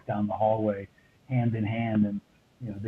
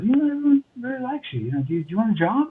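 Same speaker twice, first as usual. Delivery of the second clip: higher, fast imitation of the studio manager; imitating the studio manager; 12 semitones higher, faster than usual. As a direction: rattle off her job offer in a bright higher voice. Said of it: "she said".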